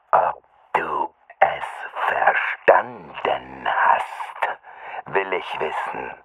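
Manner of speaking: low, very slow threatening drawl; slow menacing voice. A narrator's reading. Attacker demands the boy say he understood, slow menace. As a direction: drag the words out coldly.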